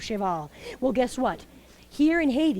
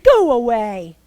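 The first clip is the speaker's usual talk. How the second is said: a sharp, louder go away; bossy order; 9 dB louder, slower than usual. Acted. Well keeper turning the cow away, bossy and loud. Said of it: "And Mabuya said".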